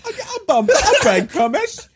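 high-pitched Cockney through giggles; mock Cockney accent. Asking for a favour, giggly high Cockney accent. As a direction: beg in a squeaky fake Cockney. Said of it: "like, a Cockney accent".

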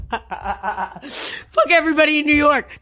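high, slow, laughing through the curse; laughing mock rant. Joking about New Yorkers' anger, laughing mock rant. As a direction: ham up the rage while cracking up.

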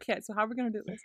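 softly talking to himself, amused; quiet little planning voice, laugh underneath; imitated self pep talk. Fan imitates actor prepping himself, quiet and amused. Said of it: "preps himself".

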